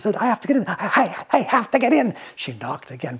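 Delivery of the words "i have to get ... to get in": higher, eager pleading voice; insistent princess. Acted. Elizabeth begging the dragon to let her in, high and urgent. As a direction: push to get in, stammering.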